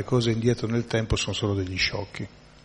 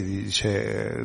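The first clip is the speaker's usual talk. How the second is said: ordinary talk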